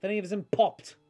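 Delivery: put-on high, loud voice with a thick accent; alarmed accented doctor. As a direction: question urgently as an eccentric foreign doctor. Game master voices accented doctor.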